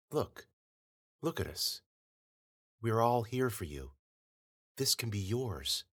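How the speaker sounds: soft, hushed persuasive voice; coaxing wife. A narrator's reading. The false Melanie coaxes Bennett to let go, softly. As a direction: gently lure him to give in.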